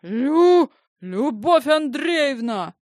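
high, sputtering outraged cry; choking with indignation. A narrator's reading. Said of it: "задохнулась от возмущения Зинаида".

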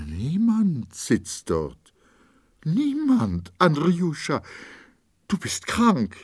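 high, loud, anguished insistence; wife pleads with her husband in fear; frightened pleading. A narrator's reading. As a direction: beg him to see there is no one there.

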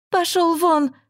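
high, furious shout; woman throwing her lover out, shouting; shouting get out. A narrator's reading. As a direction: yell him out of the house. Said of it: "крикнула Люба".

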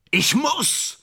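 high, strained cry; desperate outburst. Acted. Actor insisting he must play the role, desperate cry. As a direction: burst out with it, pitch leaping.

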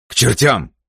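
high, explosive angry outburst; furious. A narrator's reading. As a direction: explode and cut the objection off. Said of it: "Джерри заколотил от ярости". (яростная вспышка)

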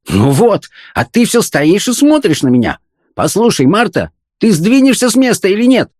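high-pitched tirade of a hungry husband; furious yelling. A narrator's reading. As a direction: rant at the wife in mounting exasperation. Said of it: "сопровождаемое настоящим воплем ярости".

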